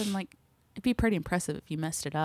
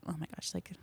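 excited whisper; 11 dB quieter, faster than usual. Retold or imitated. Excited about the chef's food.

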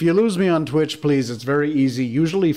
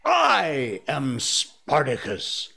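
put-on old man yelling; much slower than usual. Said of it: "an old man ... yelled".

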